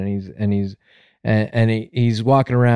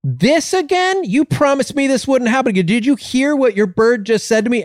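high-pitched indignant customer; acted outrage; 12 semitones higher than usual. Customer complaining to owner a second time, high-pitched outrage. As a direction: protest in disbelief, pitch way up.

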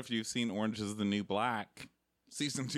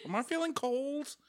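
whiny high-pitched mock voice. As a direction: play a fussy guy, voice way up. Mocking Travis in the shower.

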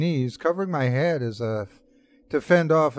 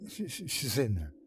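soft, halting stammer, much quieter; frightened stammer; 11 dB quieter than usual. Acted. Narrator tells his friend the woman is inside, quietly stammering. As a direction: stammer it out, scared and hushed. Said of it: "I stammered".

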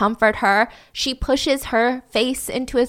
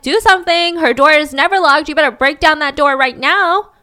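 higher, louder, urgent demand; retold screaming; 4 semitones higher, 6 dB louder than usual. Roommate yelling at the cops to break in, retold screaming. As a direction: imitate a frantic yell without a full scream. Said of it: "she starts screaming at the cops".

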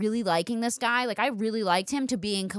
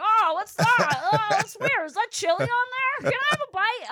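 oblivious sleeper; 13 semitones higher, 4 dB louder than usual. Acted. Sleeper who notices nothing odd, in high mock wonder. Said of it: "I'd be like".

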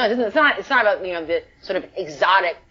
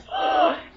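huge gasp. Retold or imitated. Overwhelmed moment.

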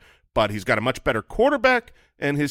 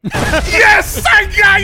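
high, loud victory yell; triumphant shout; 11 dB louder, much slower than usual. Real, not acted. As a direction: shout it like you just won. Wins the draft-pick bluff.

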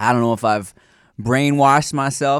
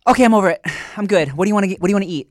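higher, louder chirpy tone; mock breezy ex; 6 semitones higher, 4 dB louder, much faster than usual. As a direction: move on cheerfully as if nothing happened. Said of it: "be like".

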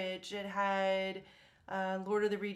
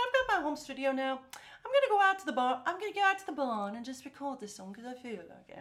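mock British accent, higher; 4 semitones higher, 3 dB louder, faster than usual. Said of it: "she probably doesn't talk like that".

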